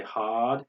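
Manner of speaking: "hard" as haad, no R; slow British "hard"; British long vowel. Shown as a demonstration. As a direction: stretch the ah in "hard", drop R. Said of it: "prolong the A sound".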